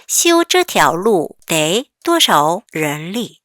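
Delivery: very slow, each syllable drawn out for learners; slow read-aloud. Shown as a demonstration. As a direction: read it slowly for the class to follow. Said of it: "같이 읽어볼게요". (천천히 또박또박 읽기)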